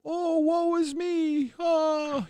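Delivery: very high, slow, whiny fake self-pity; milk it like a fake victim; mock woe is me. Attention seekers faking pain, mock whiny woe. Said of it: "putting on their fucking".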